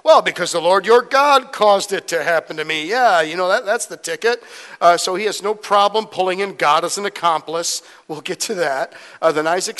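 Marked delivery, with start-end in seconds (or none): 0.0-4.4 louder comic fibber routine; hammed-up liar pleased with his alibi; John Lovett. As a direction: oversell the made-up excuse. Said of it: "pulling his, his, you know, John Lovett's routine".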